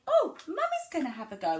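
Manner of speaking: high, playful mum talk; demonstrated mummy-to-child voice. Shown as a demonstration. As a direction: pitch it up as if to a toddler. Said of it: "you could say".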